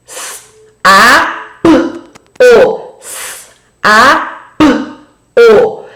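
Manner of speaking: S, A, P, O sounded separately with pauses; sounding out. Shown as a demonstration. Teacher sounds out "sapo" for the children, slow and separated. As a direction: break "sapo" into its sounds, one at a time. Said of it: "eu montei a palavra sapo".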